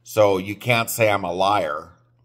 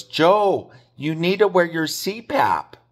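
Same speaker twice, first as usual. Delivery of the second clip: lightly voiced nagging mom, pitched up; imitating his mother fussing at him, higher; mom's voice; 7 semitones higher than usual. Mom scolding Joe about his CPAP, higher mock mom voice.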